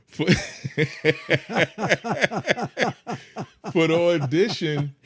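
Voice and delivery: long laugh, then loud high words; cracking up. Real, not acted. Guest starting his audition story, cracking up. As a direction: struggle to restart through real laughter.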